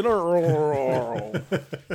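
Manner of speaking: slow groaning noise of a sagging inflatable; deflating ornament impression. Shown as a demonstration. Joking about deflated lawn ornaments, a drawn-out deflating groan. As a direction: imitate an inflatable slumping as it deflates. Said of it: "that's my impression of a".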